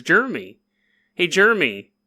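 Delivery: "Jeremy" cut to two syllables, JER-mee; mispronounced name. Retold or imitated. Host re-enacting how he called his friend, "Jeremy" said as "Jermy". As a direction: greet him with "Jeremy" said as "Jermy". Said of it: "that's how you said it".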